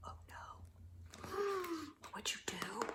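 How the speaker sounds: hushed aside to the listeners; whisper. Real, not acted. Reader whispering to the children, hushed. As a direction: lean in and whisper the question.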